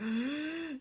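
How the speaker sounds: sharp held-breath gasp; acted intake of breath; tense gasp. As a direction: suck in air as if escaping. Imitates holding breath while escaping.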